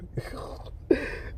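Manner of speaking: soft, voiceless wheezing laugh; real breathy wheeze of amusement. Real, not acted. Storyteller remembering laughing with his wife, wheezing laugh.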